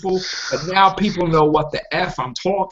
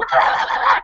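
laughing. Real, not acted. Laughs at own mockery.

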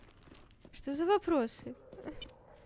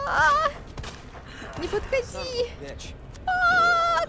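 high panicked screaming; terrified shout; 12 semitones higher, 6 dB louder, slower than usual. Real, not acted. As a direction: scream in real fright. Attacked by monster. (панический крик)

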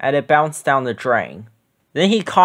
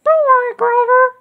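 put-on much higher little girl voice; squeaky Betty Lo reassuring. A narrator's reading. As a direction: comfort him like a sweet small girl. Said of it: "said Betty Lo".

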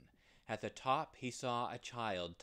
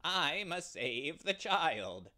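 higher, louder and slow; ram's cry; 6 semitones higher, 5 dB louder, slower than usual. Acted. The ram cries his vow, higher, louder, slow. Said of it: "cried the ram".

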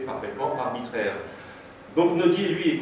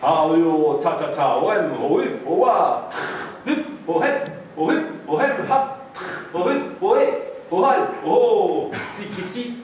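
loud, high whoops and staccato syllables, dying away at the end; onomatopoeia performance; 5 semitones higher, 5 dB louder than usual. Shown as a demonstration. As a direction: act out each noise like a human sound-effects machine. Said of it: "ça donne ça".

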